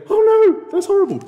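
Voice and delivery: mock dismay. The friend threatened with chocolate, loud mock horror. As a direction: cry out in exaggerated horror. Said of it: "And they go".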